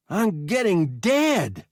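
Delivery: high, loud, slow grim whine; whiny hurt boy. A narrator's reading. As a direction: say it slow and bitter.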